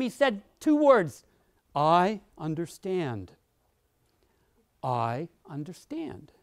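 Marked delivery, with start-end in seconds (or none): 1.7-6.3 slow, softer, lower "I understand"; soft sincere understanding. Shown as a demonstration. Leader calming an upset employee, soft and slow. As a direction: say "I understand" gently and slowly, like you mean it. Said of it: "to say two words to every response".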